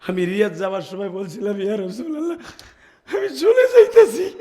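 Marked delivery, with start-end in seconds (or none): 1.6-4.3 high, loud wailing farewell; tearful pleading. Retold or imitated. Pilgrim bidding farewell to the Prophet, tearful pleading. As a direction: plead at full pitch as if breaking down.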